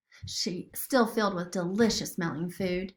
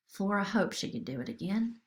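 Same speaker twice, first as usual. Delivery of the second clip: plain reading voice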